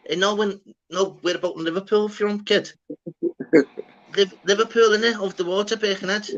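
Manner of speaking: high, eager fake Scouse accent; faked Scouse accent. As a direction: overdo the Scouse to sound local. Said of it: "He's like".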